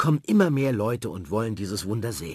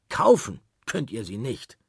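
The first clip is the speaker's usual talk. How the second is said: plain reading voice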